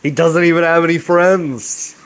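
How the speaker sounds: a mild mock sneer at the lonely guy; mocking jab. [name] teasing Vinny about a phone call, lightly mocking. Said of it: "He's like".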